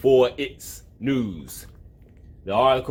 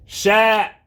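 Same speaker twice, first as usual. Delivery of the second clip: yelling shut up; 5 semitones higher, 4 dB louder than usual. Real, not acted. Yelling at the noisy bird.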